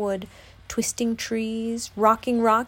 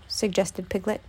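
ordinary delivery, nothing marked

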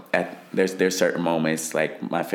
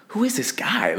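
very high, incredulous squeak; acted disbelief, pitch leaping up; baffled inner thought. Fighter hearing an unknown voice instead of coach, baffled.

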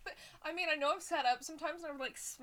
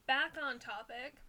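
normal talking voice

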